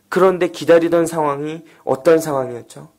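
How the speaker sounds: ordinary talk, nothing marked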